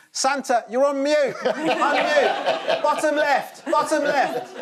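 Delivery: high, urgent shouts at the screen; desperate child shouting. Acted. Child shouting to Santa on a Zoom call, desperate. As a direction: yell instructions like an exasperated child. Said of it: "the desperate child shouting".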